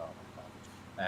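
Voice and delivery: whispered, slow, soft; whispered dismay. Retold or imitated. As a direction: breathe it out in shock. Seeing fire truck at house.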